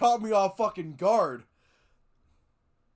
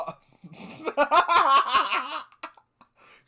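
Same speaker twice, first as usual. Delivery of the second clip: high burst of laughter breaking off the line; loud laugh; 8 semitones higher, 7 dB louder than usual. Real, not acted. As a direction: crack up mid-sentence. Can't read line for laughing.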